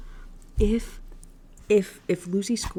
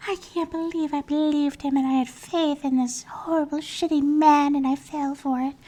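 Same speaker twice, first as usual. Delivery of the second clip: higher, whiny mock sob; mock crying; 7 semitones higher than usual. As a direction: play her heartbroken, hammed-up wailing. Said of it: "She's crying".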